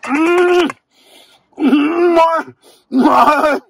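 very high, stretched cries of delight; ecstatic. Real, not acted. Tasting the ponzu fillet in his car, ecstatic and very high. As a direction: squeal with joy at the taste.

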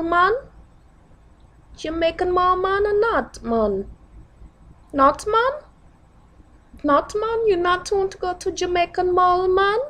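high, hammed-up Jamaican accent. Shown as a demonstration. Pressing a man to visit the Jamaican mall, Jamaican accent. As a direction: plead in a put-on, sing-song Jamaican accent. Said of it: "that's gonna be the Jamaican".

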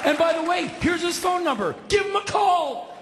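high, excited shouted instructions; urgent yelling. Retold or imitated. Caller telling cops about his friend, urgently yelled. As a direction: escalate into a high comic holler.

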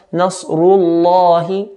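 slow nasrullahi with a thick, dark ll; heavy lam. Shown as a demonstration.

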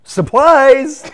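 loud, high punchline shout. Acted. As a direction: belt the punchline out.

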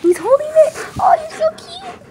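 very high squeaky gush; squeaky praising a dog. Real, not acted. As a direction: squeal over the cute puppy. Gushing over the cute dog.

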